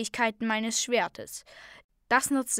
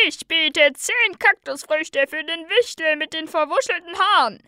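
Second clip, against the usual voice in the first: high, loud bidder in a crowd; shouted bid; 7 semitones higher, 10 dB louder than usual. A narrator's reading. A bidder offering fruit for the gnome, high shout. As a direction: call your bid over the crowd.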